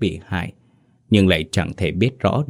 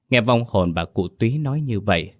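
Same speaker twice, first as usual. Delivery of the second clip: ordinary delivery, nothing marked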